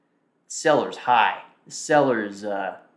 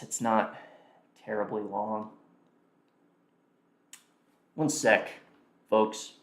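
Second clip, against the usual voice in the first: ordinary talk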